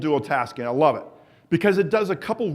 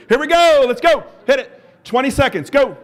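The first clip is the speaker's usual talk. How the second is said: loud, high coach's countdown call; loud start call; 8 semitones higher, 7 dB louder than usual. Real, not acted. Coach launching the interval to the walkers, loud and high. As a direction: fire up the room to go.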